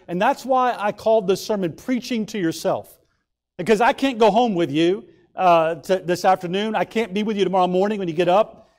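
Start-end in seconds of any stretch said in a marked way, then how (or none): none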